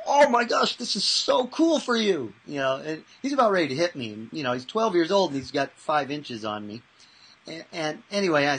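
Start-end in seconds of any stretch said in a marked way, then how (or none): 0.1-2.3 high, loud and excited, slowing on so cool; enthusiastic. Retold or imitated. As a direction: gush with over-the-top delight. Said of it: "I go".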